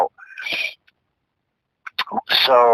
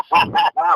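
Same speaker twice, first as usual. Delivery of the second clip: laughing; 3 semitones higher than usual. Real, not acted. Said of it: "don't talk when I'm laughing".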